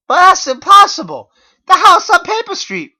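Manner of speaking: very high, loud protest; shrill denial; frantic protest. Acted. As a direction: protest in disbelief. Narrator protests.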